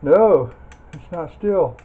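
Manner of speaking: normal talking voice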